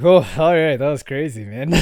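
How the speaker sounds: loud drop of the act; relieved real voice. Acted. Leaving work out of character, loud relief. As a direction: burst out casually after the pretence. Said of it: "you just switch to your real voice".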